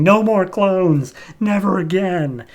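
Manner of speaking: retold screaming. Clone smashing the clone tubes, half-voiced drawn-out yell. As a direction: drag out each word like a battle cry, lightly yelled. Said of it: "just screaming".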